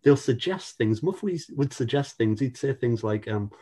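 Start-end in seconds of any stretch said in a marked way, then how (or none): none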